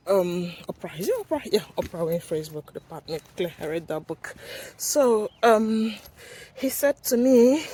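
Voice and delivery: ordinary delivery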